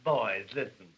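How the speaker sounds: imitating a dainty, fussy gentleman; prissy New York reporter. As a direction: mince the words like a dandy. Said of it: "You'll be talking like that".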